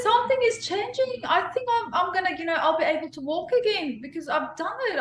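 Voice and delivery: excitedly telling friends on the phone that she can walk again; thrilled news. Retold or imitated. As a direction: blurt out the good news on the phone, overjoyed. Excitedly calling everyone after moving toes.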